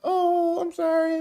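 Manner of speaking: high, fake-sorry voice of the woman; mock apology. Commentator mimicking the woman apologizing, high mock voice. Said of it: "she was like".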